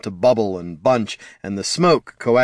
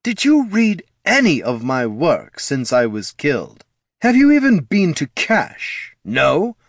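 higher, scolding run of questions; exasperated, affronted grilling; indignant questioning; 6 semitones higher, 3 dB louder than usual. A narrator's reading. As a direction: challenge them, pitch leaping with indignation. Offended lich berates son with questions.

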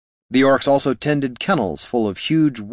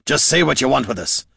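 higher-pitched, impatient snarl; 5 semitones higher than usual. A narrator's reading. Dwarf confronting the orc chief, snarled. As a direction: snarl it as a demand. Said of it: "snarled Gorm".

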